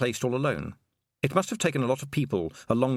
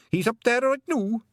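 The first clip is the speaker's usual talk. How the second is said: high, loud Scots pixie voice, eager to please; a wee Scottish pixie chirping up to Tiffany; Feegle pixie voice; 13 semitones higher, 4 dB louder than usual. Acted. Pixie telling Tiffany his long name, high and eager.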